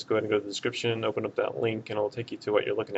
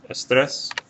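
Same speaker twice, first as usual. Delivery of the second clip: estrés hit louder and higher on TRÉS; stress on last syllable; 4 semitones higher, 6 dB louder than usual. Shown as a demonstration. Teacher giving the tenth answer, estrés stressed on TRÉS. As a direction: punch the TRÉS ending of estrés. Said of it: "stress is on that last syllable".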